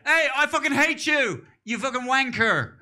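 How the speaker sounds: loud, high furious yell; a hammed-up angry shout; angry insult. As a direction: bellow it at someone you hate.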